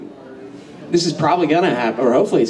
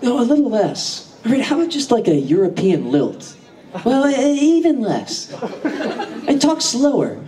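mock audition director; 6 semitones higher than usual. Actor retelling French accent audition notes, mock director voice. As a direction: mimic a fussy director giving notes. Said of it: "They're like".

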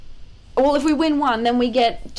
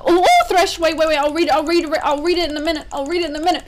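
loud and rushed, raised pitch; panicked shouting; 4 semitones higher, 6 dB louder, faster than usual. Real, not acted. Gamer yelling at the screen in a panic. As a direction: yell in a panic as it goes wrong.

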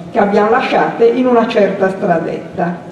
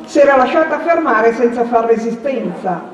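plain reading voice

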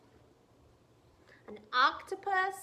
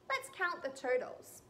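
ordinary delivery, nothing marked

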